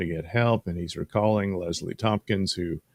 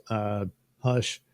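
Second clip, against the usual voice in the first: ordinary delivery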